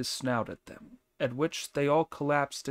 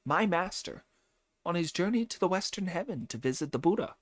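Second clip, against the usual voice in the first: higher, brisk, cocky monkey hero voice; boastful Monkey character; 6 semitones higher than usual. Acted. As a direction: brag about your powers, voice pitched up. Monkey boasts to the old man.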